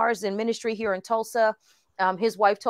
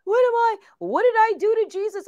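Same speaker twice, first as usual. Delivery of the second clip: distraught disbelief; 11 semitones higher than usual. Retold or imitated. Distraught recalling God's calling.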